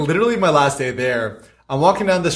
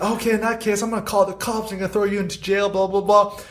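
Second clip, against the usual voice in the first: higher mock threat to call the cops; imitating the threatening lady; 7 semitones higher than usual. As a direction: pitch it up, a hint of smug threat. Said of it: "She's like".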